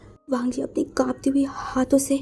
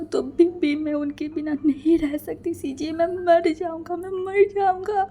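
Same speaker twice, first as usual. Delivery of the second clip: sobbing plea; 3 semitones higher than usual. Acted. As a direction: plead brokenly as if heart is shattering. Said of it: "फूट फूट के रो रहा था".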